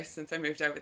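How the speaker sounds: ordinary talk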